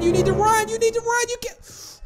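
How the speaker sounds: high-pitched frantic yell, trailing off; frantic, high repeated plea; panicked urging. Real, not acted. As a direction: urge someone to run in a real panic. Panicking as Piggy closes in.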